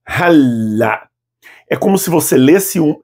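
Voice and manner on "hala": "hala" with the L held before the second syllable; doubled L held; held double L. Shown as a demonstration. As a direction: lean on the L in "hala". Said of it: "preciso que você faça ali uma tônica".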